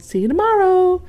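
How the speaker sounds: high, loud, slow spooky warning; high sing-song call into the dark. A narrator's reading. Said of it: "She called".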